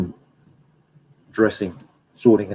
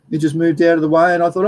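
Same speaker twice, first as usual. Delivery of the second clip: ordinary delivery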